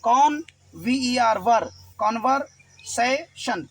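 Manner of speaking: कॉन, वी ई आर, वर, कॉन्वर्सेशन built slowly, letter group by group; slow spelling out. Shown as a demonstration. Teacher blending कॉन्वर्सेशन slowly for students. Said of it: "अब देखिए, सी ओ एन".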